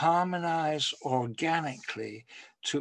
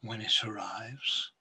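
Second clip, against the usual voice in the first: "when it arrives" breathed as a soft whisper, lower; whispered echo; 3 semitones lower, 3 dB quieter than usual. Acted. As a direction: let the refrain fade into a whisper. Refrain repeated as whisper.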